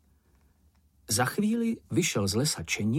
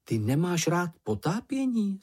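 higher, wondering question; surprised question; 7 semitones higher than usual. A narrator's reading. Mumínek asks whether his friend dislikes diving, surprised question. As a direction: ask in mild astonishment, pitch raised. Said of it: "zeptal se Mumínek udiveně".

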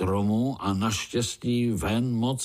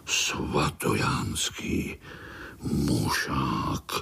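slow, low, hushed menace; menacing; 7 semitones lower, much slower than usual. A narrator's reading. Uncle names the monster to little spiders, menacing. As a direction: intone the dreaded name ominously. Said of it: "Zaznělo to tak hrozivě".

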